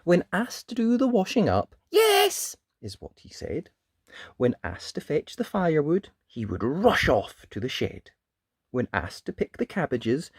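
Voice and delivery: put-on lively read-aloud voice for children; animated picture-book narration; storybook reading. A narrator's reading. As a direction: tell it like bedtime story time.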